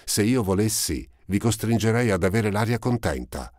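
put-on high, eager girl's voice, breathless at finding him; a ragged young girl, glad at last; Eponine's voice. A narrator's reading. Eponine finds Marius again, high and eager.

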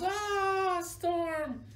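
high mock-ecstatic crowd cry; cheering crowd. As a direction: imitate a whole room of gushing fans. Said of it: "Everybody goes".

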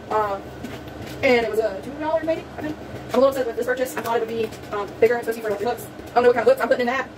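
ordinary delivery

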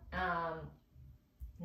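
usual speaking voice

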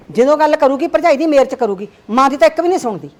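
loud, bitter complaint; angry complaint. Acted. Mother complaining that her daughter only listens to her sister-in-law, loud and bitter. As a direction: hurl the grievance.

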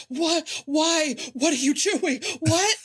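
high, panicky, rising questions; panicky flustered. Retold or imitated. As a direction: play a man in a rising panic. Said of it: "he's, like, having a nervous breakdown".

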